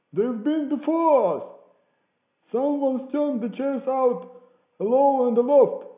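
high, very loud report; shouting down. A narrator's reading. Man at the window reporting to the beggar, loud shout. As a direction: holler the news down to the road.

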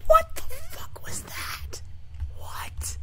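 voiceless, aghast whisper; whispered disbelief. Real, not acted. Player baffled by what just happened, whispered disbelief. Disbelief after game mishap.

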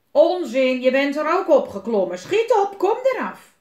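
loud, high pirate shout; angry scolding. A narrator's reading. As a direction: yell at the boy to get down. Willem angrily orders boy down. (boos schreeuwend commanderen)

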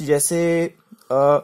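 ordinary talk, nothing marked

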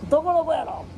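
high, playful put-on mother voice; mock-innocent mum, squeaky high. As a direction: pretend not to know your own son. Mother's fake innocence, bucket splash.

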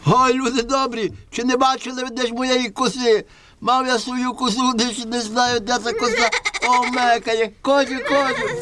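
high, sing-song peddler voice. Acted. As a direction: hawk the goat like a trickster.

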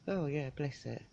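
usual speaking voice

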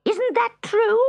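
high, loud accusing witch; raging witch. A narrator's reading. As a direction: hurl the accusation at the cat. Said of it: "raged the witch".

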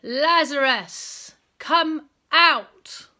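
loud, slow commanding call; booming, drawn-out summons. A narrator's reading. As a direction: summon him with a great shout, a gap between words.

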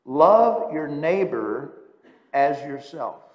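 preacher proclaiming the commandment; solemn scripture recital. Acted. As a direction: read it out as a holy command. Quoting Leviticus.

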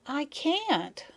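put-on high-pitched small child protesting; little child. A narrator's reading. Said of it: "said little Maya".

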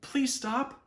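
high, pleading voice; pleading bear. A narrator's reading. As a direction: beg him to stop. Bear pleads with fleeing friend.

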